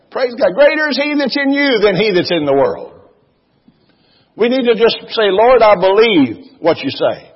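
high, loud, fervent preaching; preaching at full force. Real, not acted. Pastor urging the congregation to act in faith, fervent. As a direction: build to a shout of faith.

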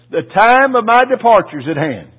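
high, loud preaching proclamation; proclaimed quote. Retold or imitated. Preacher proclaiming Paul's letter to the congregation, loud and high. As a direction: proclaim it to the church.

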